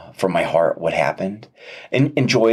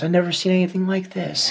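high, choked-up voice of a crying doctor; retelling a doctor's tearful words, high and emotional; 10 semitones higher than usual. Retold or imitated. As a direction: play the doctor close to tears, pitched up. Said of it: "And she starts crying".

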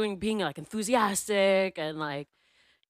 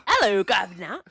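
put-on British hello; mock British accent; 4 dB louder than usual. Shown as a demonstration. Copying the cohost's shout-out to Max, mock British accent. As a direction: echo the greeting in hammy British. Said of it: "this is my British".

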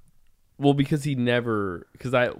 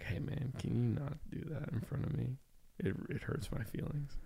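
very soft imitation of a hurt friend; retold gentle objection; 3 semitones higher, 14 dB quieter than usual. Best friend asks him to stop, meekly and hushed. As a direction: quote him meekly, voice dropped right down.

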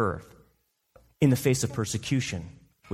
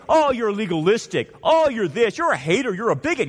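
mocking critics; 7 semitones higher, 4 dB louder than usual. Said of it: "They say".

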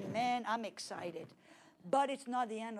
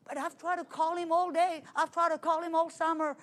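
louder, higher protesting complaint; higher, louder exasperated protest; arguing; 5 semitones higher, 6 dB louder than usual. Retold or imitated. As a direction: argue back, exasperated. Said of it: "starting to argue with the Holy Ghost".

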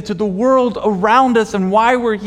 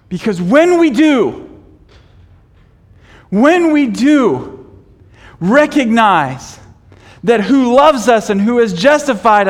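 raised, fervent preaching; 5 semitones higher, 5 dB louder than usual. Acted. Pastor urging the congregation to trust, in a fervent preaching voice. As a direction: pastor urging the church to let go, preaching hard.

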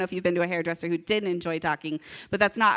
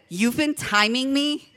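higher, drawn-out offended question; upset disbelief; 5 semitones higher than usual. Retold or imitated. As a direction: retell it as an incredulous, stretched question. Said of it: "I was upset that he even clocked that".

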